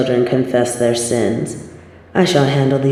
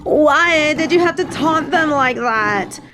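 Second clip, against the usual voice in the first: panicked; 10 semitones higher than usual. Acted. Panicked at charging lions.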